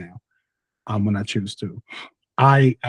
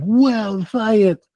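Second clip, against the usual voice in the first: high, wide-eyed mock wonder; mock awe; 9 semitones higher than usual. Child voicing his awe at Beakman's Lab, high and wondrous. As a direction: gush over science like a kid. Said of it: "and I was like".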